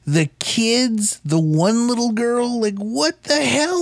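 high-pitched, drawn-out incredulous listing; exasperated bafflement. Real, not acted. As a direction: stretch each item out high, utterly baffled. Exasperated listing of plot holes.

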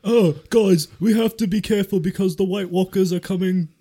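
high, loud alarmed call; urgent mock warning. Sperm character warns the others, high and urgent. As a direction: warn the troops in a put-on voice.

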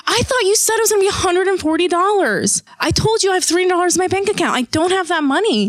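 retold complaint, pitched high and insistent; indignant protest. Retold argument with vet receptionist.

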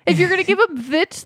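incredulous rant; exasperated disbelief. Real, not acted. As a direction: throw up your hands at the writers. Exasperated rant about the character.

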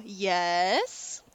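drawn-out yes swooping up into a question; dad answering phone. Retold or imitated. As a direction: lightly imitate a dad picking up. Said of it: "in a more dad voice".

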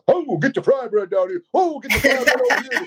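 powwow emcee. Retold or imitated. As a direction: call it out like a powwow MC. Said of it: "a powwow M C or announcer".

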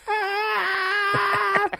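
long high frog screech; high-pitched wail of a frog; imitated frog scream. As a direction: squeal on and on like a dying frog. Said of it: "it just sounds just like that".

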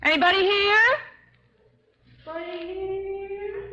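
high, ringing calls across a canyon; calling out. Acted. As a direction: call out into the empty distance.

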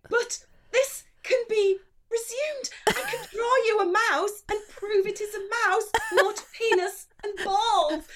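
high, insistent plea; mock pleading. Diner begging to redraw her mouse, hammed-up pleading. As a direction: plead high and dramatic, hamming it up.

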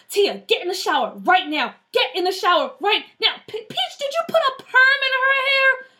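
high, alarmed commands rising to an appalled question; urgent ordering. Acted. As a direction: take charge in alarm, climbing to disbelief. Big sister giving urgent orders.

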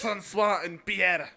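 slow, loud French names; over-the-top French accent; mock French accent. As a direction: stretch the names in hammed-up French. Repeats names in joke French.

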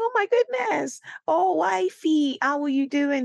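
high, thrilled squeal of delight; overjoyed hello; excited greeting. Retold or imitated. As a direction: greet her like a long-lost friend. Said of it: "And I was like".

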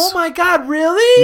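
high, loud squeal; overjoyed. Pretend. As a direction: shriek with delight. Role-play, hearing big news.

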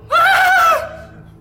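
loud high hammed-up scream, mostly laughing; mock scream. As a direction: shriek it out with a laugh in it. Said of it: "Like that".

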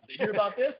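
high, eager gossip voice; high neighbor voice. Retold or imitated. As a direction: chirp it like the nosy neighbor. Imitating neighbor Alan again.

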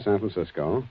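usual speaking voice

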